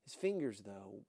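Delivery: ordinary delivery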